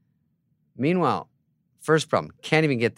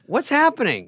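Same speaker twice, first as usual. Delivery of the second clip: bewildered; 13 semitones higher, 4 dB louder than usual. Retold or imitated. Lost in the stadium crowd.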